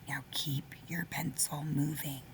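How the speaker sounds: gentle unvoiced whisper. Real, not acted. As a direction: whisper the reminder. Quiet reminder.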